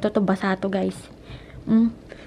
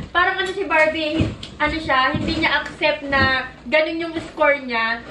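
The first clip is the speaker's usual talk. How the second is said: ordinary talk, nothing marked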